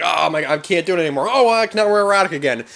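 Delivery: hammed-up viewer who can't take it anymore, then perks up; overwhelmed viewer. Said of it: "and you're just like".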